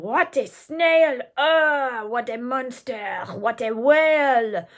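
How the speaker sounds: loud, slow greedy shout; shouting mob. Acted. The French mob shouts about the snail, loud and slow. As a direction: bellow in delight. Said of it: "The French were shouting".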